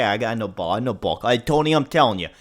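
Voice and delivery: ordinary talk, nothing marked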